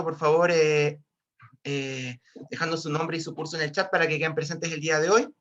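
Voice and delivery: ordinary talk, nothing marked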